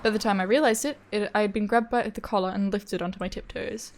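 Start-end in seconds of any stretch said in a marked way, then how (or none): none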